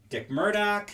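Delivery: usual speaking voice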